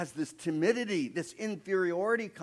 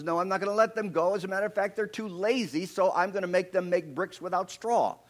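louder, higher, fast and dismissive; lightly voiced scornful Pharaoh; defiant Pharaoh; 6 semitones higher, 6 dB louder than usual. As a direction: brush off the slaves' request. Preacher voices Pharaoh refusing.

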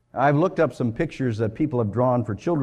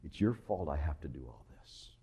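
hushed accusation; 9 semitones lower, 13 dB quieter than usual. Acted. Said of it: "She was saying".

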